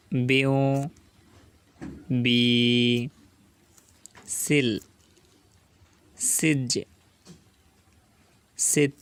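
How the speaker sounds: single syllables, each held apart by long pauses; slow syllable drill. Shown as a demonstration. Quran tutor reads syllables for learners to echo. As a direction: read each sound out slowly for students to repeat. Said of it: "এক আলিফ টান দেব".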